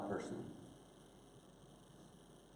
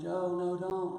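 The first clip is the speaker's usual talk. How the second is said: put-on child's plea; 8 semitones higher, much slower than usual. Child pleading with brother.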